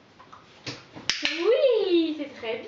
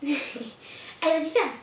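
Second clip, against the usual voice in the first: ordinary talk, nothing marked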